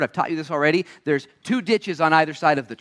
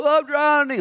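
mock panic; 12 semitones higher, 5 dB louder than usual. Mock drowning person cry.

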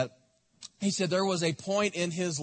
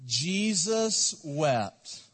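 very slow, drawn out; slow unison recital; much slower than usual. Shown as a demonstration. Pastor leading the church in reciting, slow. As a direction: say it together with the congregation. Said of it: "Let's say it with me".

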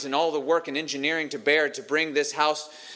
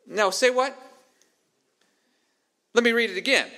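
higher-pitched, puzzled double-take; mock disbelief; 6 semitones higher than usual. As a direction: react like a baffled listener. Acts out listener's startled double-take.

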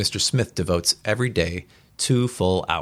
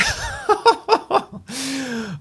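a bright burst of laughter, falling away; spontaneous reader's laugh; real laugh. Real, not acted.

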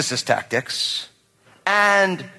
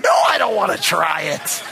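refusing dad. Retold or imitated. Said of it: "they'll be like".

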